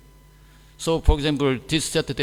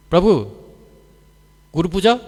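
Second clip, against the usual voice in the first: louder, clipped two-word question; blunt clipped ask; 4 semitones higher, 10 dB louder, faster than usual. Retold or imitated. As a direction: fire off only the key nouns, loud. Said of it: "you know how they ask me".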